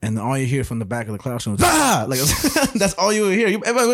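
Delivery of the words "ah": high, loud mock sneeze yell; explosive sneeze. Classmate sneezing from the back of the classroom, explosive sneeze. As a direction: imitate a crazy sneeze that sounds like an attack. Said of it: "her sneezes were crazy".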